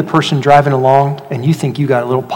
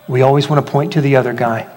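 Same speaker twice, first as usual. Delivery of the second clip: ordinary talk, nothing marked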